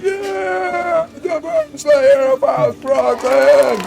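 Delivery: very high, slow, loud mock chant; crowd cheer. The crowd hails the Worm Slayer, high and drawn out. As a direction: cheer his title like a fan. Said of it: "and everybody's like".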